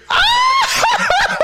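very high, loud anguished wail; a guard hears a sudden high wail from the room; loud wailing cry. Acted. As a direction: let out a piercing sob-like wail. Guards hear Joseph wailing.

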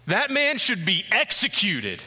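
David furiously demanding death for the rich man; indignant outrage. Acted. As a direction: demand the execution, fired up. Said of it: "He's fired up. He is so indignant".

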